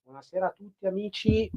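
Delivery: muffled and distant, bad microphone; greeting through a broken mic; faulty mic. Real, not acted. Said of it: "scusate il microfono".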